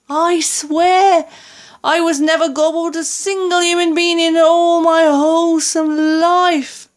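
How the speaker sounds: high-pitched frantic denial; screeching. A narrator's reading. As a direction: protest in terror as a pleading giant. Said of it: "he screeched".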